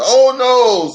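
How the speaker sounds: alarmed bear father exclaims; Papa's dismay. Acted. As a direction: voice Papa as trouble arrives. Papa dismayed at approaching car.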